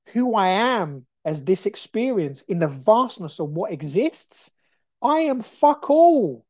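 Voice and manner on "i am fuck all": higher, louder and slow, hammering it home; emphatic outburst. Real, not acted. Realising his smallness, a loud emphatic cry. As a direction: spell out your own smallness with force.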